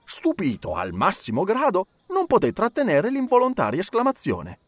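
ordinary delivery

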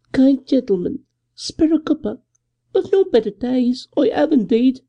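high, thin, wheedling beggar woman; thin high whine asking for a copper. A narrator's reading. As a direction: plead like a ragged old woman in the street. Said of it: "a thin voice accosted me".

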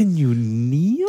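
very drawn-out, rising question; slow, incredulous repeat; incredulous echo. Real, not acted. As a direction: stretch each word in disbelief. Listener echoes the absurd request.

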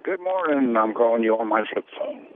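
normal talking voice

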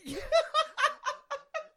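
high-pitched burst of laughter. Real, not acted. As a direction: laugh in giddy disbelief. Laughs after the title win.

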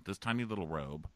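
ordinary talk, nothing marked

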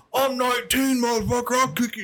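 high, hammed-up angry punk; rowdy punk voice. Scrawny drunk guy confronting the cops, high mock yell.

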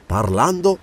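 plain reading voice

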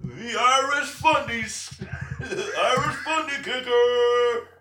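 high, drawn-out hype introduction; mock announcer. Podcaster hypes a wrestler's nickname, mock announcer. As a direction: stretch the name out high, laughing between words.

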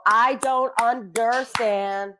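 slow, spaced-out words; slow puzzlement. Shown as a demonstration. Comedian showing how she queries a script, slow puzzlement. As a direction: space out each word as if baffled. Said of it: "Or, or I go like this".

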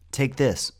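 high, loud urgent command. A narrator's reading. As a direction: thrust it at a friend with urgency. Urgent handover facing a monster.

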